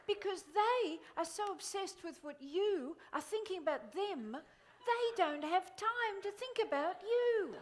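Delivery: ordinary talk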